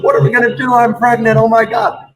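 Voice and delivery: wife breaking pregnancy news by phone, panicked. Retold or imitated. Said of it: "She goes".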